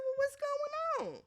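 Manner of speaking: high-pitched sassy quote; high mock exasperation. Retelling her reaction to the show, high sassy voice.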